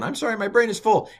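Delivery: high-pitched mock apology; very high comic aside; mock plea. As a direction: squeak it like an overwhelmed pupil. Mock aside as an overwhelmed learner.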